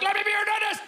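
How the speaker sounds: high-pitched frantic yell; comic high shriek; frantic scream. Acted. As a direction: blurt it at strangers in a high scream. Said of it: "going up to people and be like".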